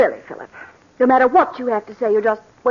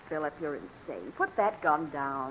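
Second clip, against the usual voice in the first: much softer, lower, slow, shaken; frightened plea; 8 semitones lower, 13 dB quieter than usual. Acted. Wife facing her husband's gun, softly frightened. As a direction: breathe it out in fear.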